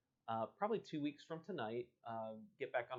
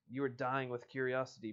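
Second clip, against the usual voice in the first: ordinary talk, nothing marked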